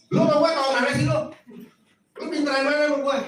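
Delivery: high, fast, scornful comeback; mock arrogant retort. Preacher mimics a proud worker rejecting correction. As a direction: sneer it back fast and high.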